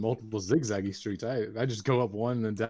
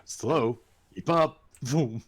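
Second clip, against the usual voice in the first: taunting; 3 semitones higher, 5 dB louder, much slower than usual. Acted. Taunting a slower runner.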